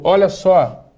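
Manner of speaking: ordinary delivery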